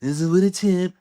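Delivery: mocking imitation. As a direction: parody a voice you object to. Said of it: "I don't talk like that".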